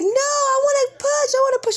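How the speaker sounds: high loud whining plea; desperate insistence. Retold or imitated. Mother in labor telling daughter she wants to push, high loud whine. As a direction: refuse to wait.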